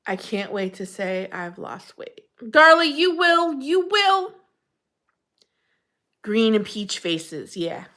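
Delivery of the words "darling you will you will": reassuring. Real, not acted. Reassuring a viewer.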